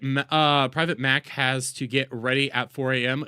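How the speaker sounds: ordinary talk, nothing marked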